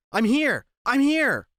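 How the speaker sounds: high, urgent call to be found; yelling. A narrator's reading. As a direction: cry out across a distance. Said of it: "I yelled".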